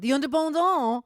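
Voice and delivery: Frenchify The Independent for a laugh; jokey French take on The Independent; mock French. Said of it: "as the French would say".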